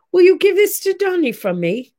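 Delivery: high, light imitation of an English accent; a lightly voiced English accent, high-pitched; English-sounding accent. Olivia offering her bouquet to Donnie, light English accent. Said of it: "That was very English, wasn't it?".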